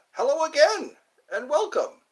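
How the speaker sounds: high, loud British greeting; British accent. Acted. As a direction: put on a high-pitched British welcome. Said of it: "I like the British accent too".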